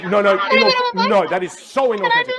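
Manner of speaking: emphatic objection, flustered; mock indignant refusal. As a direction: cut in as an offended student. Student refuses a second time.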